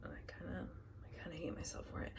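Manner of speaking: very soft, half-whispered confession; hushed, quick self-reproach; muttered aside. Real, not acted. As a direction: mumble it under your breath.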